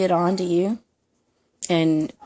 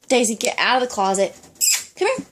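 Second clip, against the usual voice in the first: sudden aside to the dog, pitched up on come here; half-scolding, half-coaxing call to Daisy the dog; 6 semitones higher, faster than usual. Real, not acted. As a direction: call out to the pet in the next room, higher. Calling her dog mid-video.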